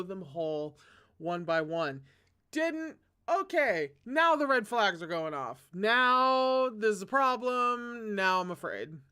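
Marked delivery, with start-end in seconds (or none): 2.5-3.0 shocked high squeak; disbelieving high question; surprised. Real, not acted. Reader reacts to sinister line. 3.3-8.1 alarmed. Real, not acted. Reader alarmed by the villain.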